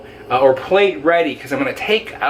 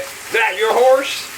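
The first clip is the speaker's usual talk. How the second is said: 1 hammed cowboy accent; 8 semitones higher, slower than usual. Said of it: "cowboy accent sort of thing".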